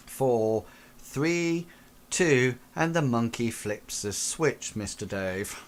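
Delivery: normal talking voice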